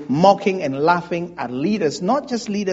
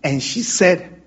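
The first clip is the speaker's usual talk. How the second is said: ordinary talk, nothing marked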